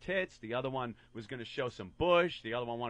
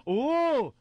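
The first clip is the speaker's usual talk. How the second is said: impressed ooh; 10 semitones higher, 6 dB louder than usual. Retold or imitated. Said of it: "a bunch of girls going".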